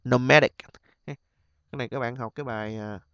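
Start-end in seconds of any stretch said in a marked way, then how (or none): 0.0-0.5 Nomadic with MA louder; stress before -ic. Shown as a demonstration. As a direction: say Nomadic as no-MA-dic. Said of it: "nhấn trước đó ha". (nhấn âm hai Nomadic)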